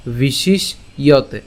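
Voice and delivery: bishish held, then iyote; bishish-iyote split. Shown as a demonstration. Teacher explains the sh, bishish-iyote in two parts. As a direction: slowly break bishishyote after the second sh. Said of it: "মূর্ধন্য স পর্যন্ত উচ্চারণ করলাম".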